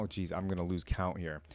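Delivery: usual speaking voice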